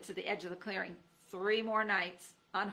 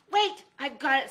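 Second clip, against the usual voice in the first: excited; 5 semitones higher, 10 dB louder than usual. A narrator's reading. Fred's sudden idea.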